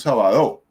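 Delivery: "sábado" mal acentuado como "sabadó"; acento mal puesto. Shown as a demonstration. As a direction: carga la fuerza en la DO final de "sábado". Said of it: "ni decimos". ("sábado" dicho como palabra aguda, sa-ba-DÓ)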